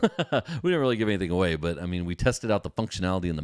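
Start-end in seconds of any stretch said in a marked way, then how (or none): none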